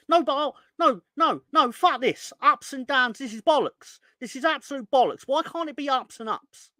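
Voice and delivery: high, loud angry outburst at the team; furious rant. Real, not acted. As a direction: let it all explode, yelling. Fan raging at his team.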